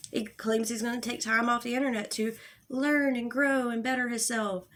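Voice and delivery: ordinary delivery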